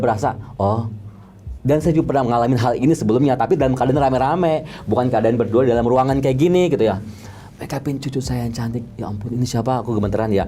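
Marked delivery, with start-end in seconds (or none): none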